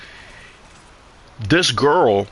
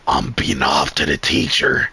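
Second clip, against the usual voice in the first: much lower, half-joking imitation of the classmate; mock dumb classmate voice; 11 semitones lower than usual. Retelling a classmate's boast in class, mock low voice. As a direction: drop low and play the goofy kid bragging.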